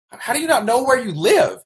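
can't believe what he just heard; incredulous question. Retold or imitated. Coach asking the kid in high disbelief.

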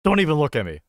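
high, loud mock-indignant shout; emotional outburst. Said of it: "getting a little emotional".

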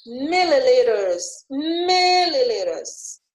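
"milliliters" slowly, MIL stretched and strong; stress on first syllable. Shown as a demonstration. Teacher giving another example to students, drawn-out first syllable in "milliliters". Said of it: "falam a primeira sílaba mais forte".

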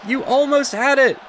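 high, emotional commentator lament; worked-up ringside call; anguished play-by-play. Real, not acted. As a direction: cry out over the near miss. Lamenting a near win.

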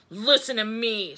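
loud, commanding order; stern shout. A narrator's reading. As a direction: shout to demand attention. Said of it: "shouted Gus".

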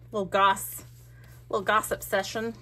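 ordinary delivery

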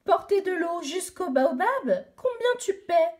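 put-on a cheeky boy asking for pay; garçon qui marchande. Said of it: "a demandé un garçon". (un garçon malin qui négocie)

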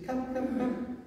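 Jesus gently calling Peter to come; gentle coaxing. Acted. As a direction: coax him forward like a child. Jesus calling Peter onto water.

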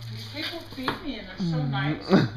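cat's inner voice. Retold or imitated. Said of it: "She's like".

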